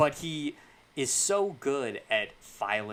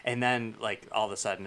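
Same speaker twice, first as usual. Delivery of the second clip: ordinary talk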